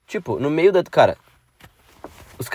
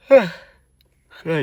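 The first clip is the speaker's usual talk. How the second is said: yawning; 5 semitones higher than usual. Real, not acted. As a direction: yawn while talking. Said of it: "desculpa pelo, pelo bocejo aí".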